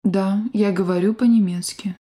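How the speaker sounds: ordinary talk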